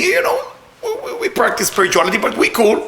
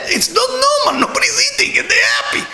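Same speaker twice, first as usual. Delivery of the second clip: high-pitched, exasperated disbelief; incredulous amazement. Real, not acted. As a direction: cry out in comic bafflement. Exclaims in amazement at happy dancers.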